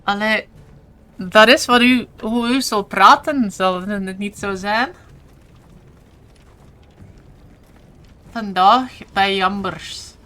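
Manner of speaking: put-on playful Flemish accent with lightly rolled R's; put-on regional Flemish accent. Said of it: "roll my R's a bit".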